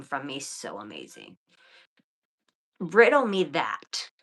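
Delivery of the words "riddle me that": louder, slow "Riddle me that."; defiant challenge. Real, not acted. Daring her ex-husband, loud and defiant. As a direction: dare them, slowly.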